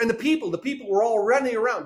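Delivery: high, hurried mock excuse; flustered excuse. Preacher acts out Saul blaming the people, flustered excuse.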